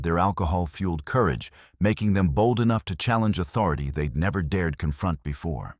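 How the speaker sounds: ordinary delivery, nothing marked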